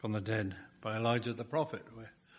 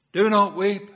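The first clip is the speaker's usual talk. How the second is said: a loud, higher, ringing command; Jesus commanding; 8 semitones higher, 11 dB louder than usual. Acted. Jesus telling the weeping widow, loud and commanding. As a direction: voice Jesus forbidding her tears with force.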